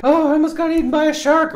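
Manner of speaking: high, loud cartoon squeal of alarm; Flounder impression. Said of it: "Flounder finally gets back".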